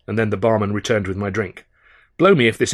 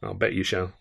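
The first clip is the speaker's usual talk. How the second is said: low, soft mutter to himself; a quiet sour aside to himself; 3 semitones lower, 7 dB quieter than usual. Acted. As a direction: grumble it under your breath. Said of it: "I muttered to myself".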